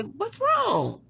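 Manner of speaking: high-pitched, lilting retold question; imitated concerned question. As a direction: ask it way up high, as a retold check-in.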